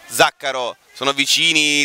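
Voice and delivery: ordinary delivery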